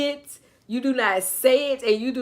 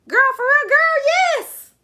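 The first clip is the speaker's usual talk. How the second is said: excited exclamation; 14 semitones higher, 7 dB louder than usual. Real, not acted. Host marvelling at her life, high laughing shout.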